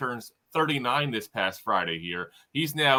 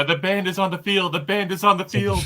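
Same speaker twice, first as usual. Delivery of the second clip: Doug Flutie call; 8 semitones higher, 6 dB louder than usual. Retold or imitated.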